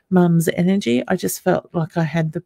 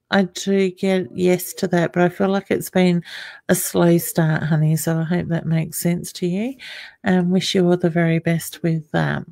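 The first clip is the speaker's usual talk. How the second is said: ordinary talk, nothing marked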